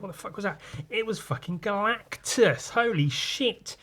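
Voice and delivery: high-pitched retold shock, slowing at the end; mock astonishment. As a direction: act stunned, voice jumping up high. Acts Spider-Man's shock in retelling.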